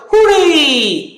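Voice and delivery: high, loud hooray; cheering hooray. Retold or imitated. Teacher imitates the crowd shouting back a high, loud hooray. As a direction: shout it like the whole party answering. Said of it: "and then you hear what?".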